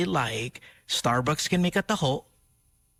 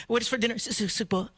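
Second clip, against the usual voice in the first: a hint of pretentious dinner-table affectation, stumbling on "sugpo"; douchey snob; 5 semitones higher, much faster than usual. Mocking sugpo as a douchey name, lightly snooty. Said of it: "sugpo is like the douchiest name".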